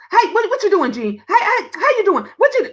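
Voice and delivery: high, excitable rapid chatter; sister's fast talk. Retold or imitated. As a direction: imitate a sister firing off greetings. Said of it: "She talk real fast".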